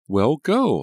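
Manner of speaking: high, loud eager push; urging. A narrator's reading. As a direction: urge her on brightly. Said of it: "Liza urged".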